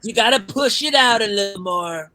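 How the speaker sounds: demonstrating the right Trump growl; Trump impression. Shown as a demonstration. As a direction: push some gravel into a Trump voice. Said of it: "You gotta kinda talk like this".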